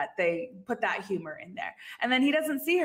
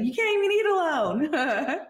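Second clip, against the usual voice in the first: mocking Ross; 6 semitones higher, 5 dB louder than usual. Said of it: "then that's when he says".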